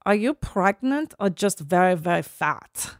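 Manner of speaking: put-on con-woman accent, slowing at the end; Anna Delvey. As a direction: imitate the character's accent, drawing out the last words. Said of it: "can't stop doing her accent".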